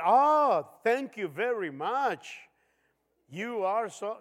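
slow, overdone gratitude; receiving a gift, mock-grateful, hammed up; mock gushing thanks. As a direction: ham up a sarcastic thank you. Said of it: "cómo lo va a tomar".

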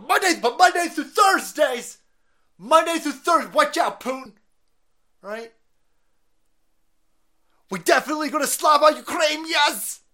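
very high shout; mock Biden shouting. Biden shouting threats at Putin, high mock yell. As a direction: yell like a feeble tough guy.

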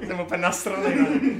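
loud, laughing mock outrage. Real, not acted. Host joking to co-host about being pissed at them, laughing loudly. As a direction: claim to be livid while cracking up.

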